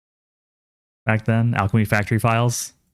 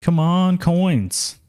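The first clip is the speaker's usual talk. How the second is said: higher, pleading come on; will the coins to drop; eager urging; 8 semitones higher, much slower than usual. Real, not acted.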